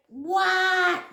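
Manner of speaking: high, stretched acted "what"; panicked question. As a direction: re-enact an alarmed call. Re-enacts panicked question again.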